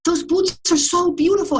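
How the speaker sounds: high, slow, delighted gush over the boots; a street woman gushing over boots, high and slow; endearing gush. Retold or imitated. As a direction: coo it like a charmed stranger. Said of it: "so endearing the way she said it".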